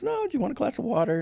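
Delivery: high, quick offer; lightly acted motherly question; imitated mother. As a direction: mimic a mom politely stalling, high and fast. Voices his mother before bad news.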